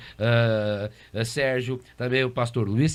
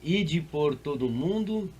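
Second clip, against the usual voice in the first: slow solemn verse reading; scripture reading; 4 dB quieter, slower than usual. Acted. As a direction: read the verse slowly, solemnly. Reads the Great Commission verse. (leitura bíblica lenta e solene)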